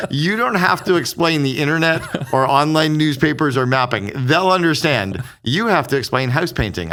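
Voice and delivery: amused half-shout of advice; mock exasperated outburst. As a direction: play the friend losing patience through laughter. Laughing retelling of Kimball's pitch.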